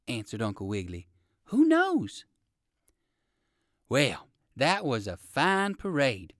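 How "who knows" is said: much higher, hopeful little lift; old rabbit voice. A narrator's reading. Old rabbit wondering about fortune, much higher.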